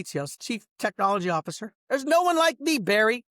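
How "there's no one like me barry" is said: high, loud self-praise; boastful outburst. Real, not acted. Bragging at former partner Barry, raised voice. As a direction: declare it loudly to Barry.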